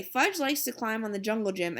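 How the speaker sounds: ordinary delivery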